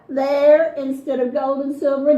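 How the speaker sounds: ordinary delivery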